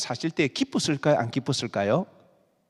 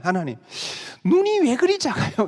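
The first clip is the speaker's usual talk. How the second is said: whiny complaint; 8 semitones higher, 3 dB louder than usual. Retold or imitated. Teen complaining to God.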